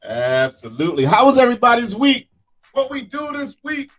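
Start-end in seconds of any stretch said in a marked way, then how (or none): 1.0-3.9 excited greeting. Real, not acted. Greeting everyone, calling out.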